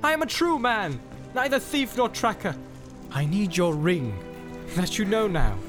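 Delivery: high-pitched, pressing appeal; urgent protest climbing in pitch; insistent pleading. A narrator's reading. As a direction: plead your honesty, pushing for what you want. Boromir pleads for the ring.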